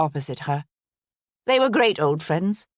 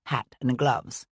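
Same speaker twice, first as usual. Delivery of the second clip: ordinary delivery, nothing marked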